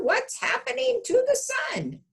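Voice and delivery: very high, frightened question; the rooster panicking at the sky; alarmed rooster. Acted.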